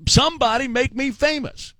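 ordinary talk

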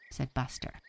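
plain reading voice

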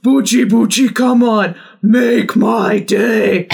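ordinary delivery

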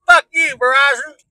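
loud, high outburst of annoyance; angry cursing. Pretend. Driver cursing a phone company, angry outburst.

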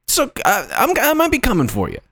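high, fast mock menace; mock threat. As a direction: play a jokey high-voiced threat.

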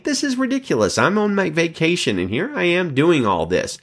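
high, exasperated muttering at the line; acting out his own impatience in the queue; mock exasperation. Pastor retelling [name]'s impatience at the McDonald's counter, exasperated. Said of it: "I was really mad at her".

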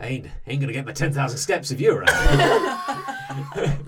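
a lightly voiced brawny barbarian, laughing through it; gruff muscle-man banter, half laughing; kith barbarian voice. GM voicing the flexing kith to the player, lightly laughing.

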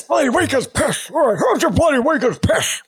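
high mock imitation of the soundbite; playful high-pitched copy of the rant; imitating Ron. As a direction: parrot the old coach in a squeaky high voice. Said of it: "Here comes Ron".

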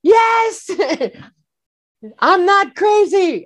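very high, laughing cry of vindication; hysterical relief. Retold or imitated. Son hearing the news confirmed, laughing high and hysterical. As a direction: shriek it with a laugh, slowing at the end. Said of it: "I became hysterical".

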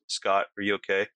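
imitating an unbothered friend; low-key, casual check-in, no urgency at all; mellow, calm. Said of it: "in the most mellow, calm voice".

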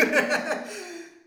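high burst of laughter; loud laugh. Real, not acted. Laughing at own imitation.

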